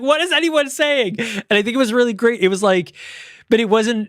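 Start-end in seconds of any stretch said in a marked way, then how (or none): 0.0-1.2 high-pitched exasperated confusion; mock bewilderment. Podcaster voicing the Mandarin speaker, mock bewilderment. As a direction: imitate someone completely lost, pitch way up. Voices last confused character in chain.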